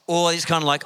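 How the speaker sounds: ordinary talk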